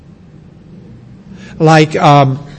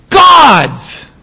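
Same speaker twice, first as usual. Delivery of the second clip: "God's" shouted, much higher; stress on God's; 6 semitones higher than usual. Shown as a demonstration. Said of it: "Paul knew where to place his emphasis".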